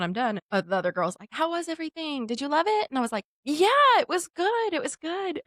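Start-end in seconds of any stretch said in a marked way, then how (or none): 1.3-2.9 higher, sing-song customer question; lightly voiced eager staffer, pitched up; imitated receptionist. Front-desk girl asks after massage, high imitated voice. 3.4-5.4 high, drawn-out mock approval; forced politeness. Uneasy client answers front desk, forced politeness.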